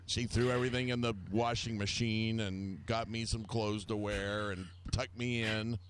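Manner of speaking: ordinary talk, nothing marked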